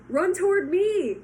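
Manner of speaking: loud, high, slow gander yell. Acted. Gander yelling to Wilbur, loud and slow. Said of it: "yelled the gander".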